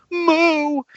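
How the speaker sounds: high, drawn-out mock moo; imitated cow moo. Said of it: "the cow just goes".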